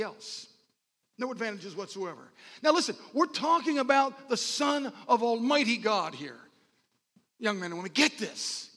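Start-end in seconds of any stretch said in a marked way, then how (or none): 2.6-7.9 higher, insistent preacher voice; emphatic preaching. Real, not acted. Preacher saying this is the Son of Almighty God, emphatic preaching. 7.9-8.7 voiced, higher-pitched urging; urgent call. Real, not acted. Preacher urging young men and women to listen, urgent call. Urges congregation, first take aloud.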